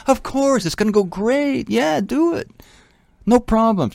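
high, breezy upbeat preacher voice, everything will be great; mock a cheerful prosperity preacher; Joel Osteen impression. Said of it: "Joel Osteen".

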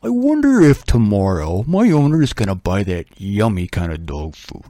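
a dog daydreaming about food; hopeful dog. Retold or imitated. Imagined dog hoping about future.

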